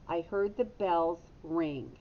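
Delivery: ordinary talk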